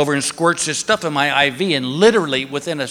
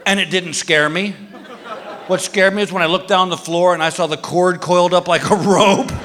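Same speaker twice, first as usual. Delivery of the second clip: ordinary delivery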